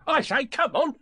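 high, loud Tigger shout; calling out. A narrator's reading. Tigger bounces up to the others, calling out.

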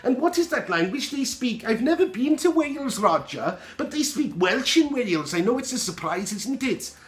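high, lilting mock Welsh accent; put-on Welsh accent. Host answering a question about Wales, hammy Welsh accent. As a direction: attempt a sing-song Welsh accent, badly. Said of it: "I can't really do a good Welsh accent".

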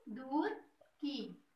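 दूर की drawn out very slowly, syllable by syllable; teacher slowly sounding out दूर की; slow sound-alike demo. Shown as a demonstration. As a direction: stretch दूर की long to echo Turki. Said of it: "तुर्की से क्या tone निकली है?".

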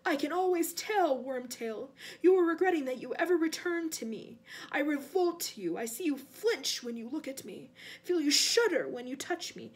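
intense, commanding villain; accusing master. Acted. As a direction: confront the servant with his disgust. Cold master accuses servant.

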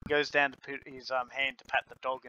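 ordinary talk, nothing marked

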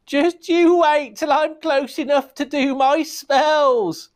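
high, slow cackling witch threat; high, slow witch voice calling after them. Acted. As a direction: stretch it out like a creeping old witch. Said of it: "the witch called after them".

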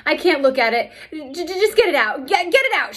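high, loud frightened pleading; screaming. Acted. Girl screaming at teacher to remove worm, frightened. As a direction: beg in a squeal to get it away. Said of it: "she screamed".

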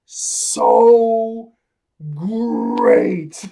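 Luffy screaming how well he slept; joyful scream. Retold or imitated. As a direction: burst out of the barrel shouting with joy. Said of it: "he screams".

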